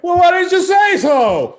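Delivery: very high, shouted, drawn-out cop imitation; mock chummy cop. Cops hearing who his dad is, mock chummy shout. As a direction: holler it slowly, suddenly best pals. Cops suddenly friendly, acted.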